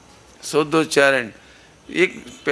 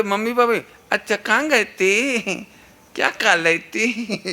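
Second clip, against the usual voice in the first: put-on higher, slower lisping baby talk; mock baby talk; 4 semitones higher than usual. Parent cooing at a toddler in higher, lisping baby talk. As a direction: coo at the child like a doting parent. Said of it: "तोतली भाषा में नहीं बोलना चाहिए".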